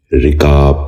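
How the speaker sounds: رکاب said "rikaab", short "i" after the r; correct pronunciation. Shown as a demonstration. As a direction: open رکاب with "ri", then long "kaab". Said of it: "آپ بھی غور سے سنیے".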